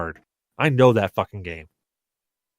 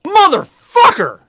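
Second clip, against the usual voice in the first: a sudden, much higher and louder cry; startled outburst; 9 dB louder, slower than usual. Real, not acted. Host startled by his co-host's interruption, real yelled outburst. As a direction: blurt it out, really caught off guard.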